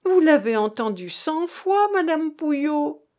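high, loud plea to a witness; loud appeal. A narrator's reading. Cook calls madame Pouhiot as witness, loud. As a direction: call on the neighbour. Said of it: "Elle prit à témoin madame Pouhiot".

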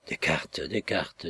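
put-on hushed whisper of a dreamy old woman. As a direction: breathe it to yourself, half-remembering. Old woman murmurs to herself.

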